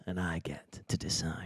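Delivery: low dramatic whisper. Pretend. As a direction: breathe it out low. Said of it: "Johnny Hammers would make".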